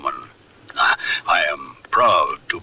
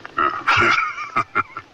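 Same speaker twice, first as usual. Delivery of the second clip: hearty laugh. Acted. Strong Wolf laughs at JJ's shock.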